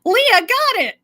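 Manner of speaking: high, loud happy call; excited. Real, not acted. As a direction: shout the name gleefully. Echoing the winner excitedly.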